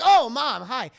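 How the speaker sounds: loud, high startled greeting; caught off guard. Retold or imitated. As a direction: blurt a mock-flustered hello. Son caught when mom walks in.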